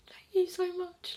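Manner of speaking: high, slow, relieved thanks; overwhelmed gratitude. Retold or imitated. Labouring mother greets the anaesthetist at last, overwhelmed gratitude. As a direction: gush it high, stretched out with relief.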